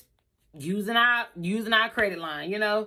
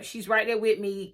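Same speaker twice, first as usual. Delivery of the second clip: usual speaking voice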